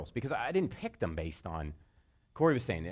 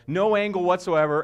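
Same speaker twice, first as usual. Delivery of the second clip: ordinary delivery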